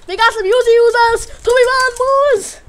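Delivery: shouted alarm about the Uzi users. Real, not acted. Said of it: "Oh no, we got some Uzi users".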